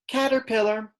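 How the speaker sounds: caterpillar said high and clear, syllable by syllable; teacher modelling caterpillar; modelled word. Shown as a demonstration. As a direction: sound out caterpillar for kids to copy.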